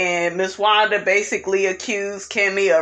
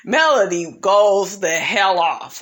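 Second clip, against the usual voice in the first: ordinary talk, nothing marked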